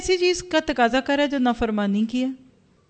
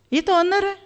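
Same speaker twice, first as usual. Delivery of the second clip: emphatic exclaim; 8 semitones higher than usual. Real, not acted. Stressing the honour of pilgrimage.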